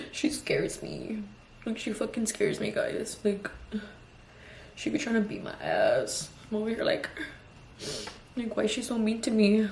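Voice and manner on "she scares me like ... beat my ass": slow hushed half-joking whisper; whispered confession. Real, not acted. Mother whispering to viewers about her scary toddler, hushed. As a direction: lean in and whisper it like a guilty secret.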